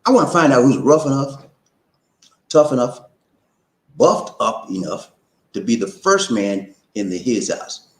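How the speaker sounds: slow and spelled out; slow it down so everyone gets it. Shown as a demonstration. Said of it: "I have to say it slow".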